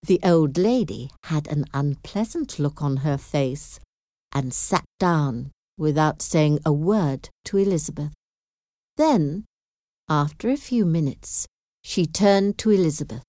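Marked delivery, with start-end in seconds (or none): none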